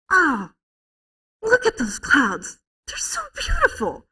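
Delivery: high, drawn-out gushing over beauty; awestruck exclaiming. Retold or imitated. Mother showing her son the clouds, gushing in awe. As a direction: gush with wonder, stretching the words. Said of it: "I was going".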